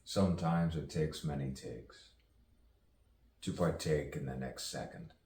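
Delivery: measured poetic recital with long pauses; spoken-word poetry. Acted. Poet musing aloud on misunderstanding and rebirth, spoken-word delivery. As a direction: recite it as free verse, letting each phrase hang.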